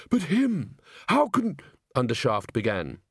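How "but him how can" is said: high, slow, shocked voice; alarmed. Acted. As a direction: recoil in alarm. Ghost protests, interrupted.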